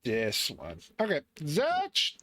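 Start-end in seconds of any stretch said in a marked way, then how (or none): 1.4-2.1 sound effect. Acted.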